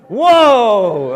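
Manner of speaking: loud, high startled cry; surprised whoa. Retold or imitated. Moses hears the bush talk, loud startled whoa. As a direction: shout it in shock. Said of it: "it's an expression of surprise".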